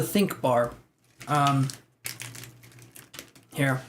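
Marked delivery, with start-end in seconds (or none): none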